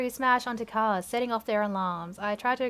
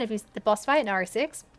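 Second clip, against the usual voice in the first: ordinary delivery, nothing marked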